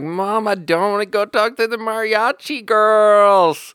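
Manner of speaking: high, slow whining drag; high-pitched, drawn-out teenage whine at mom; whiny teen protest. Retold or imitated. Teen son whining back at mom.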